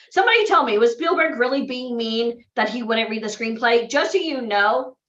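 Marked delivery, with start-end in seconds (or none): none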